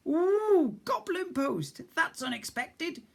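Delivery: very high cooing voice; delighted cook. Acted. Cook lights up at the news, high delighted coo. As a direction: coo with surprise like a gran.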